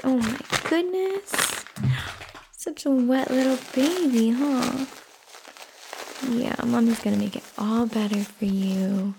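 put-on soft, sing-song baby talk to an infant; soothing a child. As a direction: coo gently like a mother changing her baby. Mother baby-talking while changing an infant.

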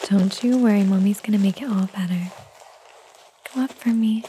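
ordinary delivery

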